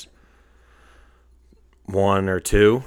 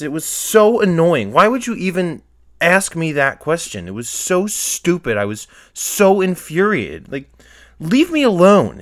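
loud, high-pitched outburst, going off the rails; infuriated rant; 9 semitones higher, 7 dB louder than usual. Real, not acted. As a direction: let rip at a clueless questioner, very loud. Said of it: "these audio levels are way up".

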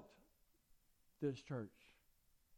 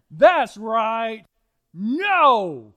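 loud, emphatic that's right, pitched higher; loud affirmation; 3 semitones higher, 14 dB louder, much slower than usual. Real, not acted. Pastor booming agreement back to the congregation, loud. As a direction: call it out to the whole room.

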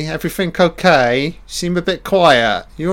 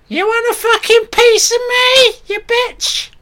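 very high, loud monstrous threat; shrieking monster; 4 dB louder than usual. Pretend. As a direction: screech the threat like a feral creature. Voicing monster Sally threatening.

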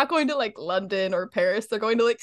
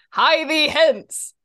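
put-on slow, louder and higher old-fashioned herald call; mock herald; 6 semitones higher, 5 dB louder, much slower than usual. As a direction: boom it out like a fair herald. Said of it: "he goes".

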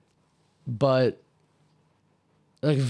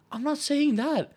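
exasperated retort; 11 semitones higher than usual. Retold or imitated. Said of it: "And I'm like".

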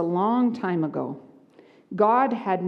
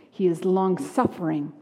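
ordinary delivery

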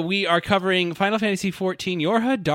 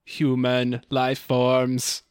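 robot voice; 6 semitones lower, 5 dB quieter than usual. Acted. Robot duplicate threat.

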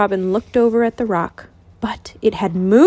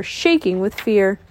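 ordinary delivery

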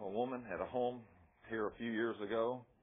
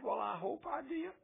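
high, timid mock voice of a doubter; unsure believer. Preacher mimics a doubtful convert, high unsure voice. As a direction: play the wavering believer. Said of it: "are you sure you got saved".

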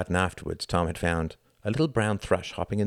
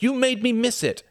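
high, loud accusing whine; cross cry; 6 dB louder than usual. A narrator's reading. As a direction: blame them at the top of the voice. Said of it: "cried Reginald".

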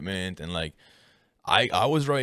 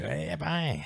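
put-on Newfoundland accent; 9 semitones higher, 3 dB quieter than usual. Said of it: "the accent".

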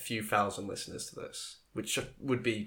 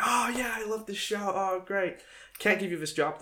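high, acted enthusiasm; gushing interviewer; 10 semitones higher than usual. Imagined interviewer praising show then refusing, acted gushing.

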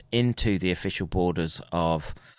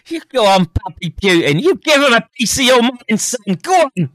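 shrill mock cheer, much higher and louder; hammed-up cheering; 11 dB louder than usual.

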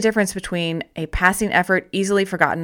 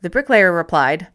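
usual speaking voice

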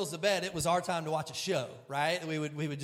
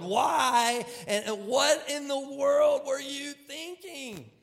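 higher, slower, loud exasperated asking; exasperated parent; 8 semitones higher, 5 dB louder, slower than usual. Retold or imitated. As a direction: plead in disbelief at the mess. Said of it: "we're asking our four-year-old".

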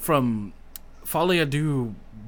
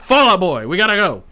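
insistent friend; 6 semitones higher, 11 dB louder than usual. Retold or imitated. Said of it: "And he's like".

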